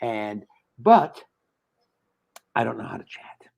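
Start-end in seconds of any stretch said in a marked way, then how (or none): 2.5-3.5 whisper. Real, not acted. Can't get into chat.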